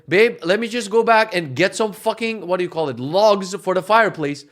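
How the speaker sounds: loud, high, slowed-down exasperation; exasperated retort. Retold or imitated. Husband telling wife about fireplace logs, loudly exasperated. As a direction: act out fed-up sarcasm to a partner.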